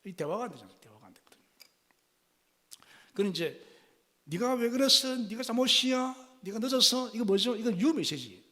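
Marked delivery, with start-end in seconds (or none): 4.2-7.1 high, sharp accusing questions; blaming questions. Shown as a demonstration. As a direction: interrogate her with reproach. Blaming father, "you message. (추궁하는 말투)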